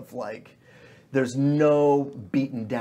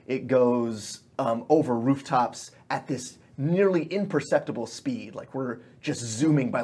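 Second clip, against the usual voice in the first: ordinary talk, nothing marked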